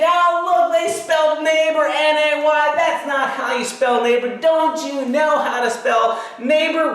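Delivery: high, loud, hammed-up scolding to shame them; mock public yelling. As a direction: crow about their mistake so everyone hears. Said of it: "imagine I'm yelling and yelling".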